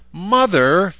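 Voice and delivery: high, loud outraged cry; outraged protest. A narrator's reading. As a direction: wail against the punishment. Daughter protests being grounded.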